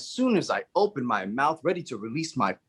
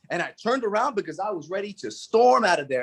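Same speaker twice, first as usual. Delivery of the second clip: ordinary talk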